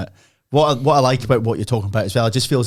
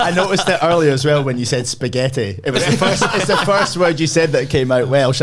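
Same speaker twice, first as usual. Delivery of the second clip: higher, louder through giggles; laughing; 6 semitones higher, 5 dB louder than usual. Real, not acted. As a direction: tease while laughing.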